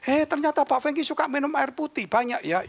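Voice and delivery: high-pitched imitation of a curious companion; chatty questioner. Dinner companion remarks to the pastor on his water drinking, high and chatty. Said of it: "dia tanya gini".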